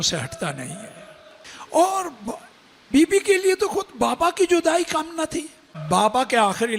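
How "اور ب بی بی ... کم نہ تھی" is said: high, pleading lament; mourning preaching cry. Acted. Preacher lamenting Zahra to mourners, high pleading. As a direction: cry it out for the daughter's grief. Grief peak of the majlis.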